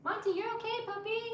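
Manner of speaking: very high cooing voice. Real, not acted. Breaking off to check on her puppy, high baby talk.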